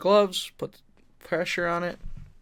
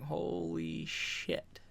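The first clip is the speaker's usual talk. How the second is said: awed murmur; 11 dB quieter, much slower than usual. Retold or imitated. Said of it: "people going".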